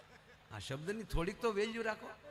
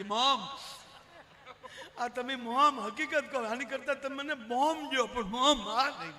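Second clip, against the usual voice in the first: loud, laughing protest; 4 semitones higher, 7 dB louder than usual. Retold or imitated. Son-in-law begging his mother-in-law not to call him that, loud laughing protest. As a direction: protest loudly, half laughing.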